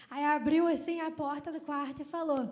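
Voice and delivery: ordinary talk, nothing marked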